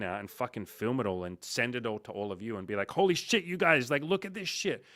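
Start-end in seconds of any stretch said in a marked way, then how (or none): 2.9-4.8 excited. Retold or imitated. Filming backstage to show everyone.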